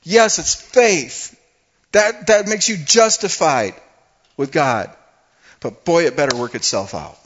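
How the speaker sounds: loud, high, sweeping sermon delivery; fiery preaching. Acted. Preacher calling the congregation to repent, full-force preaching. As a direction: drive it home to the pews.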